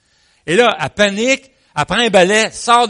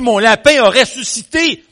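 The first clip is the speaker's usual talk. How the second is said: high, loud mock-hysterical neighbour; crying out; 6 semitones higher, 7 dB louder than usual. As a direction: cry out an impossible discovery in acted shock. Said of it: "la voisine crie". (cri de voisine affolée, joué)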